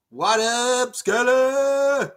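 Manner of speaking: high, loud call-out; a bright hey-there shout to a regular viewer; playful shout-out. Real, not acted.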